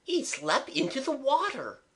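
very high, spellbound wonder; rapt voice. A narrator's reading. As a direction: marvel at it, enraptured. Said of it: "said Eliza in a rapt voice".

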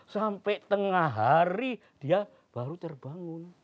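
plain reading voice

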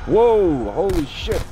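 sudden startled yell, high and loud; alarm shout. Real, not acted. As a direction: yell in shock at a sudden scare. Sudden fright in zombies game.

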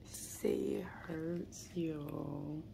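soft whispered coaxing. Real, not acted. Mother coaxing her sore daughter to tell viewers, whispered.

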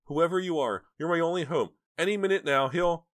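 high, loud frantic woman; desperate begging. A narrator's reading. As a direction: plead for your life at high pitch. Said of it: "the woman begged".

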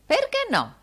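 put-on very high old woman's retort; old housekeeper. A narrator's reading. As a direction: challenge the master in a high old voice.

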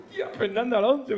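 high awed repeat of the name; starstruck again. Retold or imitated. Fan keeps gushing at Alonso, high and awed.

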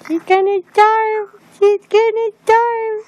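high mock-dramatic wail; high teasing singsong. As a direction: tease her in a high singsong. Repeated teasing line, high.

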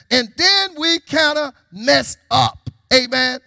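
high, loud preacher's delivery; full-force preaching. Real, not acted. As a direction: preach it out to the congregation.

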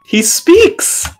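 high squeaky rising "speaks!" in mock wonder; mock amazement. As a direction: gasp it out like a miracle. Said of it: "repeats the mock amazement".

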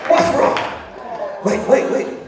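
rising preacher climax. Acted. Preacher to congregation, climbing fervent proclamation. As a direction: lift it into a fervent cry.

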